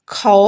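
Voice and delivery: teacher sounds out खौ; syllable khau. Shown as a demonstration. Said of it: "ख पर औ की मात्रा". (खौ का उच्चारण करके दिखाएँ)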